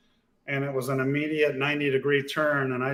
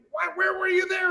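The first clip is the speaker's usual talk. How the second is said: very high, strained mock yell; retold yelling. Driver to co-driver after the bushes, mock yelling. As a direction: imitate an exasperated shouted question. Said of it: "sorry for yelling at you".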